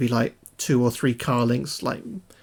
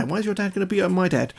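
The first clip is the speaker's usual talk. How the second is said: put-on higher, quick kid's voice; imitating a child; 6 semitones higher, faster than usual. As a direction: question it like a child on the playground. Voicing children's playground exchange.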